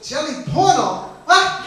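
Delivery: slow, high incredulous question; baffled echo. Acted. Customer repeating owner's strange words, baffled. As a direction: puzzle over the odd words, voice rising high.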